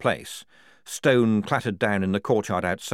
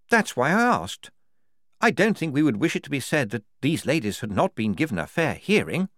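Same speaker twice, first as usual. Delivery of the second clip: higher, crisp cross-examiner; questioning major; 7 semitones higher, 3 dB louder than usual. Acted. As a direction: put the question pointedly. Major voiced in story.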